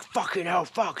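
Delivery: lightly voiced fuming man; mock angry man. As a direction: grumble like a caught shoplifter. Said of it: "he's just like".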